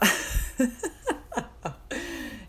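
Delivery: long, high giggle; amused laugh. Real, not acted. Vlogger laughing at imagined reply from others, high giggle. Laughs at her mimicked insult.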